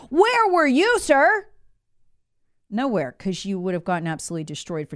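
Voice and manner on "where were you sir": sarcastic. Real, not acted. Rhetorical jab at official.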